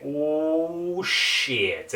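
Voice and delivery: a hushed "oh no" in the theater seat; mild retold shock at the screen; stunned reaction. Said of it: "And I was just like".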